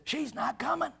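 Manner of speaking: soft, hushed servant's report; timid servant. Retold or imitated. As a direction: break the bad news softly. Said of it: "and said".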